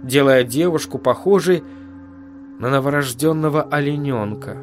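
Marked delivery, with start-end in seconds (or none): none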